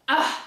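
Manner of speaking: loud, disgusted "ugh"; exasperated groan. Acted. Sulky goddess fed up with her life, exasperated groan.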